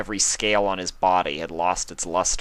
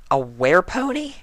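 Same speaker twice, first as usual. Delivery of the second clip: high, startled question; surprised Twilight; 12 semitones higher, 4 dB louder than usual. A narrator's reading. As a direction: echo it in disbelief.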